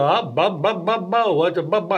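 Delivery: lightly imitated crooner's bu-bu stammer. Host shows how the singer always talked, lightly imitated. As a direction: bounce along on the bu-bu syllables. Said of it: "[name] would always go".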